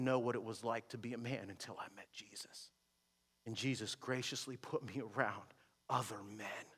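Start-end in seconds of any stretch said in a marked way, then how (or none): none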